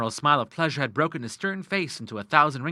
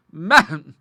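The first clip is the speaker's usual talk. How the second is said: cry out; 4 semitones higher, 8 dB louder than usual. A narrator's reading. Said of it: "cried Missus Westmacott".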